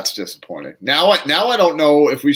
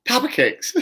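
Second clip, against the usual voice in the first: high-pitched; 11 semitones higher than usual. Acted. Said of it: "That's what we're gonna call it".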